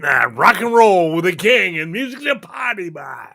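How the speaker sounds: booming late-night radio announcer. Acted. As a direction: hype it like a rock DJ. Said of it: "radio personality in Hawaii".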